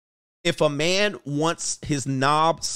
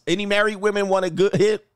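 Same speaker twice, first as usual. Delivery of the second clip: normal talking voice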